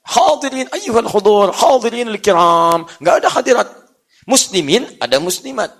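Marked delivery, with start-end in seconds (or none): 0.1-2.9 slow, drawn-out Arabic oratory; Arabic formal address. Shown as a demonstration.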